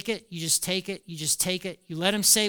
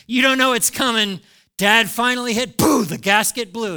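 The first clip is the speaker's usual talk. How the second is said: sudden louder burst, hammed-up blowup; mock explosion; 3 semitones higher, 8 dB louder than usual. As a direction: play the dad finally blowing his gasket. Dad finally explodes, acted.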